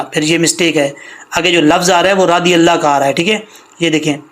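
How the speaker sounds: usual speaking voice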